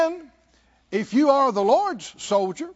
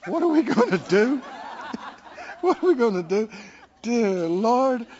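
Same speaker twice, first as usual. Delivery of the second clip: mock worry, laughing. Mocking worried talk, laughing.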